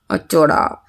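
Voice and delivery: soft low coo to a little one; tender coo. Real, not acted. Mother cooing softly to her little son.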